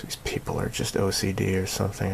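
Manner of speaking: soft unvoiced whisper; hushed confiding whisper; whispered aside. Real, not acted. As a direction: whisper it behind your hand.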